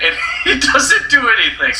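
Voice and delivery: giggling high; laughing. Real, not acted. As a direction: laugh through the line. Laughing about the show.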